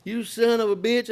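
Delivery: high, slow sputtering boss's voice; furious old boss. Retold or imitated. Boss on the phone finds the unpaid bill, high and slow. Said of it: "He called me up".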